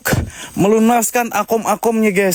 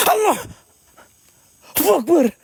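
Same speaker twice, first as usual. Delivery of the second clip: sudden high cry of Allah; jolted call-out in fright; real startled outcry; 9 semitones higher than usual. Real, not acted. Startled cry during ghost hunt.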